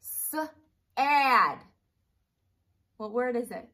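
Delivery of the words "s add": "S-" held, then a loud "add"; sound blending demo. Shown as a demonstration.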